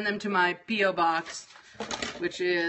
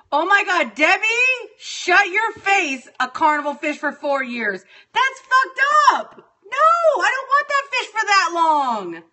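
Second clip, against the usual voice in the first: much higher and louder, an outraged wail; exasperated rant; 9 semitones higher, 5 dB louder than usual. Real, not acted. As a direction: let it burst out, fed up with the comments. Ranting about friends' fish comments.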